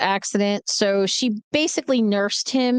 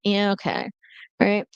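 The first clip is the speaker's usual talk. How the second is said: ordinary talk, nothing marked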